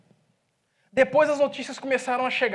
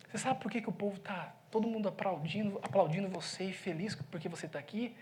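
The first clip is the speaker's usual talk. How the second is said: lower, much softer aside; quiet confiding; 4 semitones lower, 11 dB quieter than usual. Retold or imitated. Pastor confiding in the pizza man, hushed. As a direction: lean in and murmur just to him.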